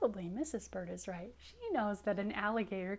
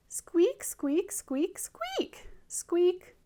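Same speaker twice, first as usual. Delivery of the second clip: high-pitched hatchling squeaks; sharp little squeals, leaping high; squeaking; 9 semitones higher, 7 dB louder, much slower than usual. Acted. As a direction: squeak loudly from inside the egg. Egg squeaks as it cracks.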